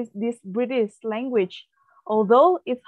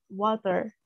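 "water" with an American accent, the r at the end said; 3 semitones lower, 5 dB quieter than usual. Shown as a demonstration. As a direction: say "water" the American way, with a soft t and a clear final r. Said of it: "in the U S A, they say".

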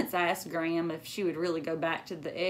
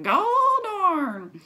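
put-on loud, high old-man cry; hammed-up country grandpa; Gramps exclaiming; 12 semitones higher, 9 dB louder than usual. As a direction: blurt it out astonished.

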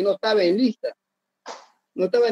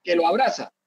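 usual speaking voice

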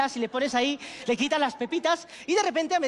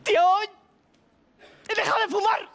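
high, excited mock bragging; acted proud announcement; 7 semitones higher, 4 dB louder than usual. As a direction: announce it like a huge achievement, hammed-up. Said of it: "se cuenta el tiempo que lleva así".

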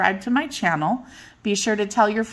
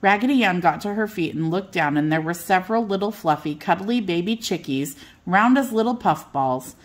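ordinary delivery

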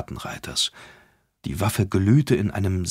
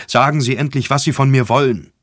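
louder, fed-up push; impatient demand; 4 semitones higher, 6 dB louder than usual. Acted. Impatient Jeffrey demands the detective's purpose. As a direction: make him finally get to the point.

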